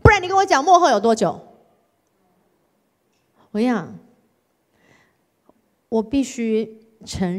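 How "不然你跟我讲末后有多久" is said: high, loud sing-song refusal; childish tantrum. Retold or imitated. A spoiled daughter refusing God, childish tantrum. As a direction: stamp your feet like a sulky child. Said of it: "就是我不听".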